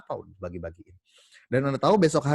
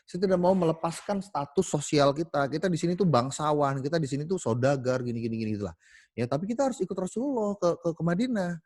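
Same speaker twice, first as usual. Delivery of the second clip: higher, protesting wife's voice; a wife clinging to her status, pitched up; stubborn wife; 5 semitones higher than usual. Acted. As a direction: refuse him like a proud noblewoman. Wife refuses to leave Mecca.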